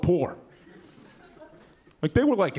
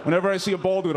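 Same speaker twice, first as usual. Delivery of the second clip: normal talking voice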